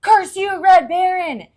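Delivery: high, loud shout at the enemy; shouted curse. Acted. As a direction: yell it at the Red Baron.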